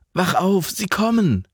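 high, urgent wake-up cry; urgent alarm. A narrator's reading. Man waking Alva as bombers approach, alarmed. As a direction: rouse her in panic.

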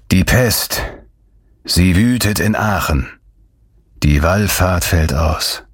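breathless, panting delivery; out of breath with grim news. Acted. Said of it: "Er keuchte".

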